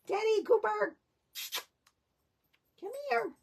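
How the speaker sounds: high, sing-song call to the dogs. Real, not acted. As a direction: call them in from across the room, pitch way up. Calling the dogs back in.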